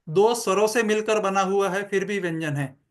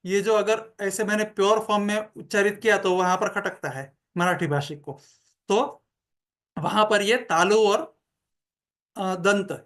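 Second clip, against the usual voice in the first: ordinary talk, nothing marked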